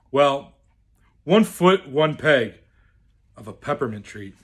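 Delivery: usual speaking voice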